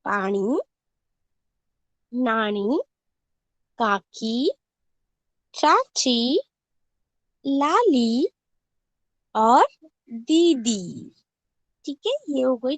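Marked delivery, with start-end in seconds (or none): 0.0-11.1 final ई drawn out, दीदी very slow; long ee endings. Shown as a demonstration. Teacher reading final long ee words to class. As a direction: stretch each closing ee. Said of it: "उच्चारण लंबा किया जाता है".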